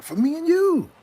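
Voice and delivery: very high sing-song croon. Pretend. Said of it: "That's how they even make it sound".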